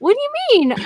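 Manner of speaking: high-pitched indignant protest; hammed-up offence; mock offended. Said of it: "so offended".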